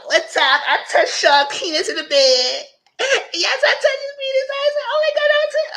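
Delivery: very high squealing mock girl voice; a high shrieking imitation of a shocked friend. Imitating Kadarius's shocked confession.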